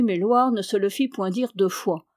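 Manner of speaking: ordinary delivery, nothing marked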